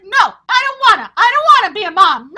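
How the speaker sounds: put-on fast, loud childish whine; whiny protest. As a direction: whine it like a kid refusing. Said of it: "I was like".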